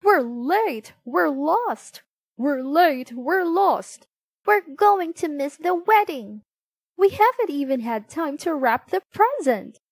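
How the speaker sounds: high, loud cries of a family in a rush; storybook yelling. A narrator's reading. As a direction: call it out high and urgent. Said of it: "came running down the road yelling".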